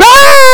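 very high, loud flailing shriek; Kermit the Frog scream. Retold or imitated. Preacher acting out panic for the congregation, high frog shriek. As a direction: throw up your arms and shriek like a frantic puppet frog. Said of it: "we do the Kermit the Frog".

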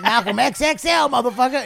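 high, loud shouted bit; mock frantic yell. Comic doing bits at his accuser, loud high yell. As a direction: holler it as a desperate routine.

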